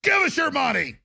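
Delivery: high, sneering mock bark; mock bullying commies. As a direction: snarl at them like petty tyrants. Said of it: "They're just like".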